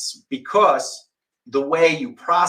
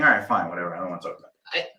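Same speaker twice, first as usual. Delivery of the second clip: much lower, sulky, trailing off; mock sulky dismissal; 9 semitones lower, 7 dB quieter, much faster than usual. Mocking the student shutting down, low and sulky. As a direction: grumble it like a sulking teen. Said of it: "you're just saying".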